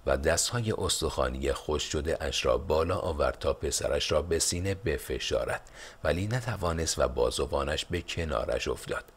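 ordinary delivery, nothing marked

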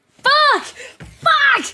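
very high, loud curse; exasperated shout. Real, not acted. Streamer realising she fell for a joke, exasperated shouting. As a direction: yell each curse in sharp dismay.

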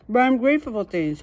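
talking with a mouth full of food; chewing seafood while talking. Real, not acted. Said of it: "I know I'm talking with my mouth full".

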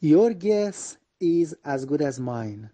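very slow, each word clear; slow model reading. Shown as a demonstration. Teacher reading the phrase slowly for Persian learners. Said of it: "teacher models phrase slowly".

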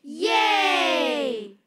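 loud joyful yay; boy cheering over game time; excited cheer. Acted. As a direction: cheer like you've won.

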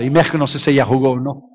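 usual speaking voice

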